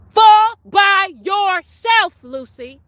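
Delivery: very slow, loud, high rebuke; drawn-out shout. Real, not acted. Rebuking Lucifer, slow drawn-out loud shout. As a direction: stretch every word of "Fall by yourself" out at full volume.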